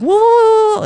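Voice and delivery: high, loud offended whoas; hammed-up high whoa; mock startled whoa. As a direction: throw up your hands in mock shock. She reacts to being called abusive.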